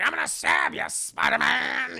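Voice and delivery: high, loud villain shout; threatening goblin. Acted. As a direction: stretch the name into a savage yell. Harry threatening Spider-Man.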